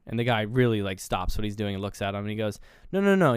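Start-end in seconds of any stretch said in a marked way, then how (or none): 2.9-3.3 high, loud string of no's; acted-out emphatic protest; urgent objection. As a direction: cut in to correct him. Agent stops and objects to the name.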